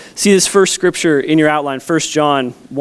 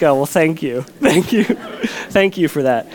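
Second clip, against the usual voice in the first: laughing thanks. Real, not acted. Dad touched by daughter.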